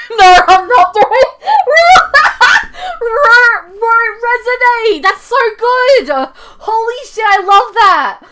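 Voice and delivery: high, loud, laughing squeal; real excited shrieking. Real, not acted. Fan learns the names make Resonate, ecstatic shouting. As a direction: shriek it through helpless laughter. Thrilled by name connection, laughing.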